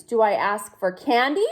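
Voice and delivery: plain reading voice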